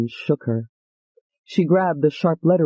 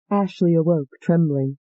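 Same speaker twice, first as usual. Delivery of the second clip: ordinary delivery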